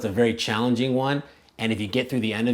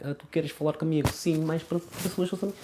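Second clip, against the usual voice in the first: higher, softer, quick Portugal accent; reserved, conservative European Portuguese, rattled off softly; closed European Portuguese; 6 semitones higher, 5 dB quieter than usual. Shown as a demonstration. Showing how they sound in Portugal, closed European Portuguese. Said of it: "that's how they sound in Portugal".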